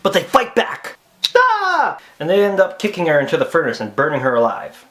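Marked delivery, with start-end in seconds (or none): 1.3-2.0 high, loud yell; mock attack scream; fighting yell. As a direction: shout it out like a battle cry. Acting out kids fighting back.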